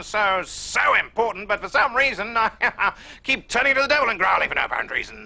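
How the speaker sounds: high, excited commentator voice with a laugh breaking in; Brian Moore impression. Mimicking the football commentator, high and giddy. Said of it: "he'll suddenly start growling".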